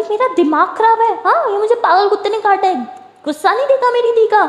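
high-pitched indignant outburst; incredulous protest. A narrator's reading. Sara refusing to calm her angry sister, high indignant protest. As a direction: protest loudly in a high, dramatic voice.

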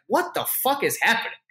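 high, astonished what is happening; mock disbelief from the crowd; stunned crowd. Partygoers watching the hug, high and astonished. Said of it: "everyone in there is like".

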